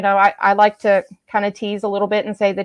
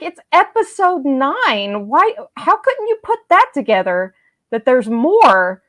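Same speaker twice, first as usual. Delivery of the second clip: slow, higher, incredulous; exasperated disbelief; 4 semitones higher, slower than usual. Retold or imitated. Fan telling the strangers it's episode nine, in slow incredulous exasperation. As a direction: spell it out slowly in disbelief.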